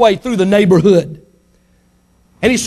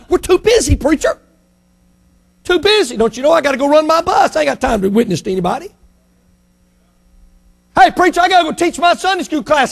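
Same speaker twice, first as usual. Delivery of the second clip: high, hurried mock church member; mock busy churchgoer; 7 semitones higher than usual. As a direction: whine your excuses at the preacher.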